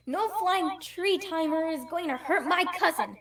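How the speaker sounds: ordinary talk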